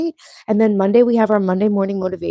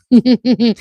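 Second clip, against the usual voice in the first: short giggle; laugh; 3 semitones higher, 5 dB louder than usual. Real, not acted. Streamer amused by own jab at commenter, giggling. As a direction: giggle in a quick run. Laughs after sarcastic reply.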